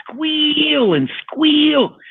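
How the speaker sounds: high-pitched, long-drawn squeal on the word; pig-like squealing, slow and high; imitated pig squeal. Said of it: "I start hearing a pig".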